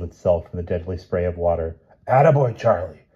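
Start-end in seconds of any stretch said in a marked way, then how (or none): 2.1-3.1 croaked cheer. Acted. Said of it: "croaked the professor".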